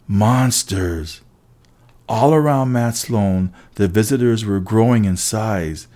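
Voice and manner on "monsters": plain reading voice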